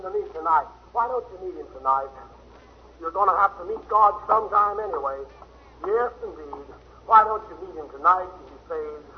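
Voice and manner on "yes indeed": ordinary talk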